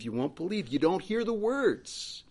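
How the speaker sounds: ordinary talk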